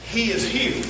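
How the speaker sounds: ordinary talk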